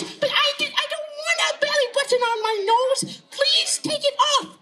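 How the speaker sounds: put-on very high, whiny child voice; squeaky kid, badly upset; protesting little boy. Tommy protests to monster.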